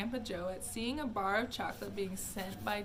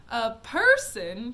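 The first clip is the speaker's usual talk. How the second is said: loud, slow, astonished; cried; 12 dB louder, slower than usual. Acted. Wonka astonished at sending a person, loud and slow. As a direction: stretch it out in disbelief. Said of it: "cried Mister Wonka".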